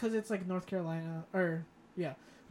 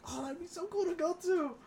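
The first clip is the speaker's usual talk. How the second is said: excited; 12 semitones higher than usual. Retold or imitated. Excited quote about cool Florida spots.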